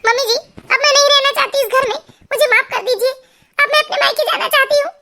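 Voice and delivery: high-pitched, distressed wish to leave; tearful daughter-in-law. Acted. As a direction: plead to go home, voice pitched high. Narrator plays tearful daughter-in-law. (दुखी बहू, ऊँचे सुर में विनती)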